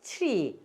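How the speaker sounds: 트리 as "chri", one blended glide; model pronunciation. Shown as a demonstration. Teacher drilling students on tree, model pronunciation. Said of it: "다시요".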